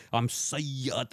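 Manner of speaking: "Sayyid" drawn out very slowly, mock pride; heavy emphasis on Sayyid. Podcaster mimicking Sayyids abroad, "Sayyid" slow and heavily emphasized. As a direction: imitate pride by stretching "Sayyid". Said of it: "putting so much emphasis on Sayyid".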